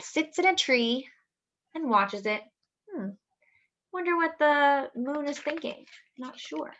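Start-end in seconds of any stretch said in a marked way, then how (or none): none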